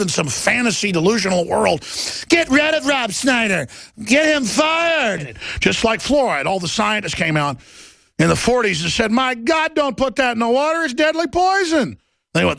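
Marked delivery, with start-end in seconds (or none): 2.3-5.4 high, stretched mocking demand; hammed-up, high-pitched outcry; mock outraged mob. Mimics outraged mob demanding firing. 9.1-12.0 high-pitched panicked warning; frantic scientist impression, pitched high; mock alarm.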